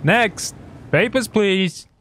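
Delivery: high-pitched inspector calling for papers; border inspector call. Pretend. Player as border inspector to the next traveler, high official call.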